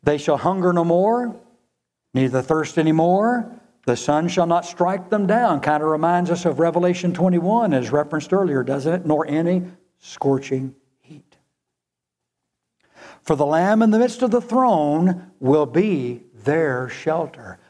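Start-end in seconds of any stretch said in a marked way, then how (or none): 0.0-5.6 loud, higher proclaimed reading; thundering pulpit reading of the promise; preaching scripture. Acted. Scripture proclaimed at full force. 9.1-17.6 loud, higher proclaimed reading; thundering pulpit reading of the promise; preaching scripture. Acted. Scripture proclaimed at full force.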